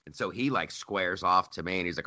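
normal talking voice

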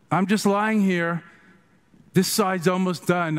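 mock-innocent deadpan explanation; unbothered reply. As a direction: play the old master lightly, quite untroubled. Acting Patrul's unbothered reply.

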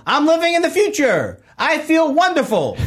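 high-pitched mock yell at the band; retold yell. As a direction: call it out high, without real volume. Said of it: "I yelled out to them".

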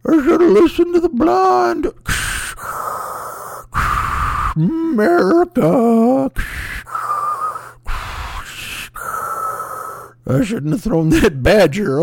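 high, loud, strained last words; dying ventilator patient. Acted. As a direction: drag out each regret through ventilator breaths.

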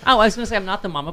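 ordinary talk

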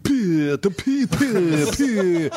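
put-on high silly kid's noise; child voice. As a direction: squeak it like a naughty kid.

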